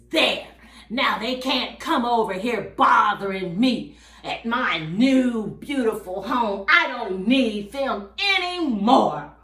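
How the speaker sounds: a touch of self-satisfied dismissal; lightly voiced proud rooster, pleased with himself; smug rooster. Rooster to himself about his unwanted friends, smug. Rooster gloats after burning bridge.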